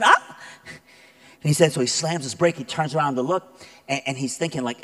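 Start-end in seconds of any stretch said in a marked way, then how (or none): 0.0-0.4 sharp high shriek of a frightened woman; quick high startled scream, imitated; retold scream. Woman in a truck screaming at a machete, high shriek. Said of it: "this woman going".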